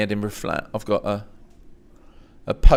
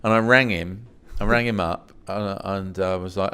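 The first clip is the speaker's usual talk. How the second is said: ordinary talk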